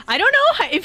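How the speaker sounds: ordinary talk, nothing marked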